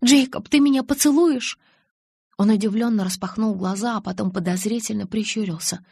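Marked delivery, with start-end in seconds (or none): 0.0-1.6 high, loud sudden blurt. Acted. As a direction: blurt it out without thinking. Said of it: "я тут же выпалила".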